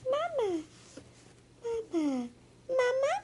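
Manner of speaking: put-on high baby calls; baby walrus calling. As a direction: answer mama in a tiny voice.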